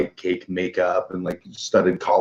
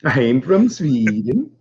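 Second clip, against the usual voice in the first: slow, higher, badly faked Swedish accent; mock Swedish accent; 6 semitones higher, 4 dB louder, slower than usual. Pretending to the bar girl he is from Sweden, mock Swedish accent. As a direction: stretch the words in a sing-song fake Swedish. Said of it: "Should have said you were from Sweden".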